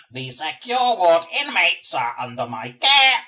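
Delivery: slow, loud, high buzzing command; buzzing demon voice. Acted. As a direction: drone the order like a swarm queen. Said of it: "The buzzing voice".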